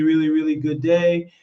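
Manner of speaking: ordinary delivery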